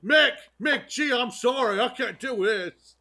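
high hammed-up English complaint; mock Bale rant. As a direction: vent as an exasperated method actor. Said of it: "He's trying to do his thing".

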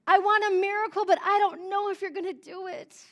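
high, pleading cry of doubt; anguished cry. Acted. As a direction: plead with God through the pain. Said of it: "to raise a cry of joy and pain".

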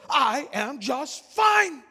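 high, slow indignant shout; loud, angry retort. Retold or imitated. Offended passenger snapping back at the preacher, loud and angry. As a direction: punch out each word, offended. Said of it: "talking so loud and so angrily".

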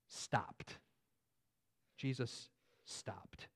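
soft, slow, hushed emphasis; hushed awe. Real, not acted. Preacher marveling that Jesus stopped, hushed and slow. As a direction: let it land in quiet wonder. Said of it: "I just like that".

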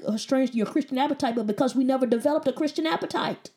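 normal talking voice

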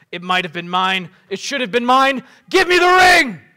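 loud, heated rant climbing to a high full shout; Boromir's furious cry. Acted. Warrior claiming the ring from Frodo, furious shouting. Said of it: "cried Boromir".

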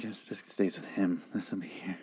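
very soft, low near-whisper; hushed graveside quote. Retold or imitated. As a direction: imitate a hushed word at a grave. Widow at grave, acted in retelling.

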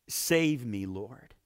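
ordinary talk, nothing marked

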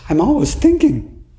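high, plaintive "I'm always thinking"; complaining student. Retold or imitated. Retreat student to teacher, a high plaintive complaint. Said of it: "some people told me during this retreat".

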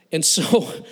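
ordinary delivery

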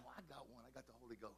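very soft, low, almost whispered; hushed. Real, not acted. Pastor confiding he has the Holy Ghost, hushed. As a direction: drop right down to a private murmur. Hushed aside after the fish cry.

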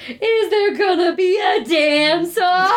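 drawn-out, high-pitched mock-dramatic question; all-caps excitement. Reading own all-caps notes to the co-host, high and slow with drama. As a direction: read it like it's written in all caps. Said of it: "I wrote in all caps".

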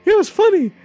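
high squeaky mock chuckle, then falsetto; Nikki Moss. Cartoon correspondent amused by the park panic, high squeaky falsetto. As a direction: chuckle and talk like a cartoon mouse. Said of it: "this is Nikki Moss".

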